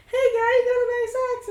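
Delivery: high, fast and loud ghost voice; friendly poltergeist. Acted. Friendly poltergeist admiring Kate's axe, high and quick. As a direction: squeak it out like an excited spectre. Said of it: "this is a friendlier ghost".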